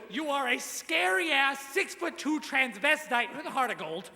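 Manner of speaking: normal talking voice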